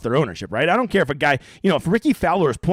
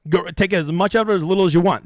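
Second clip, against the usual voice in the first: ordinary talk, nothing marked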